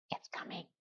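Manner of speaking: hushed, voiceless teaser; barely audible confiding whisper; whispered aside. Real, not acted. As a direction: drop to a whisper, like a secret.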